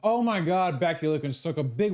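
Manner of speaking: furious outburst. Real, not acted. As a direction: blow up at the game in real rage. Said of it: "I'm so mad right now".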